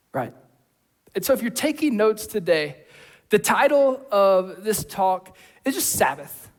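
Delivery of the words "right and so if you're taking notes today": ordinary talk, nothing marked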